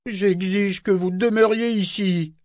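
high, slow, demanding cry; a petty governor ordering them to stay; governor's cry. Acted. The governor insists they stay, in a high cry. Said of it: "cria le gouverneur".

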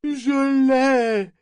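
very high, drawn-out cry; reassuring shout. A narrator's reading. Parangon reassuring Brachen as he catches Althea, shouting. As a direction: call it out long to reassure. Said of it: "s'écria Parangon pour le rassurer".

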